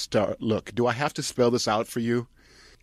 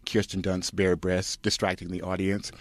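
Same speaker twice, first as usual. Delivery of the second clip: usual speaking voice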